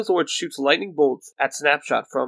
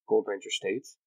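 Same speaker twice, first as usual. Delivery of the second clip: usual speaking voice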